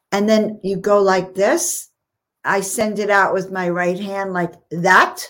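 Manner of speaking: usual speaking voice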